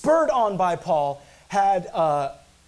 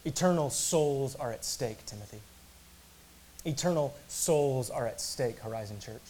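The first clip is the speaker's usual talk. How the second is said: grave warning; 4 semitones lower, 6 dB quieter than usual. Real, not acted. Preacher warning his church of what is at stake, hushed and grave.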